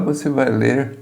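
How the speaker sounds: ordinary delivery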